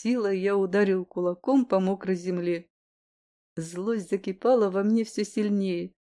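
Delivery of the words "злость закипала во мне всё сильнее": usual speaking voice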